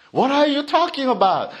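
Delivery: puzzled crowd blurting it out; baffled outburst. Acted. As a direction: fire it back in disbelief. Crowd voiced in a higher, faster take.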